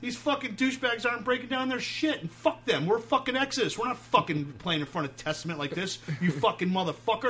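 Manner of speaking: high, loud retold yell; screaming at the manager. Singer screaming at tour manager about Testament, yelling. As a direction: shout it at him like you're heated. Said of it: "And I'm, like, screaming at him".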